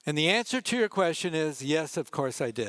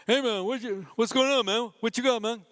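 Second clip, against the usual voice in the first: imitating a Spanish accent, higher and bright; 7 semitones higher than usual. Ballplayer greeting the photographer, in a mock Spanish accent. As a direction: mimic a ballplayer's heavy Spanish accent, pitched up. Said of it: "with a very profound Spanish accent says".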